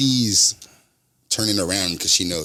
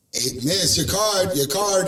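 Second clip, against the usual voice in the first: higher-pitched call after a customer; 10 semitones higher, slower than usual. Retold or imitated. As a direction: try to catch her attention. Calling after the departing customer.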